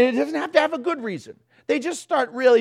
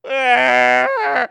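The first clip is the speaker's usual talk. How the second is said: imitated baby wail; 3 semitones higher, 6 dB louder than usual. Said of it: "another one looks at that one and goes".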